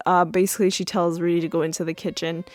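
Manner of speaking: normal talking voice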